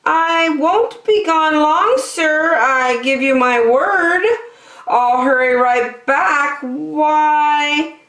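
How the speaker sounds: high, eager promising bird voice; high pleading bird. Acted. Lazy bird promising the elephant, high. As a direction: promise sweetly to win him.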